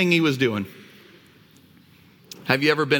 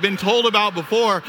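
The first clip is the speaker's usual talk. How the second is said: normal talking voice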